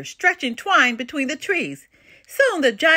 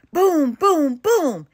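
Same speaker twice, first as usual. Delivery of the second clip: mild storybook booming, pitched up; booming shout; 5 semitones higher, 4 dB louder, much slower than usual. A narrator's reading. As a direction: boom it out like distant shouting. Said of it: "It almost sounded like shouting".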